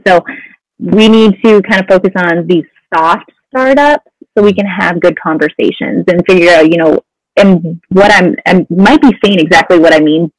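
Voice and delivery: ordinary talk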